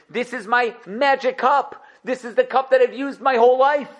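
hammed-up distraught king; Yosef's mock lament. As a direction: play a ruler pretending his magic cup was his whole life. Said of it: "He made it seem like he did nichush".

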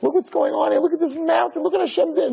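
high, excited marvelling; amazed. Retold or imitated. Rabbi showing kids the mountains, high and thrilled. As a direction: gush over every tree. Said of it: "I'm like".

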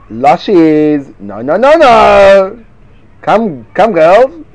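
a loud, preachy scold; scolding the aunts. Acted. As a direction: wag a finger at the girls. Chiding the gossiping aunts.

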